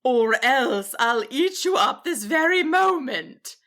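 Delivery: slow, mildly menacing dragon; menacing threat. A narrator's reading. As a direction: dragon bares teeth at the wizard.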